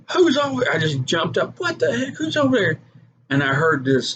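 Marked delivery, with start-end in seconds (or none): 0.1-2.8 startled call. Retold or imitated. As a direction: call out sharply, scared of something unseen. Alone at night, hearing movement.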